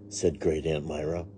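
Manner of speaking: plain reading voice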